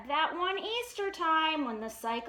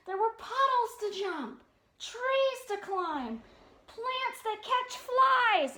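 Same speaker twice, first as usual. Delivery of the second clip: slow; 6 semitones higher than usual. A narrator's reading. Slow storybook listing.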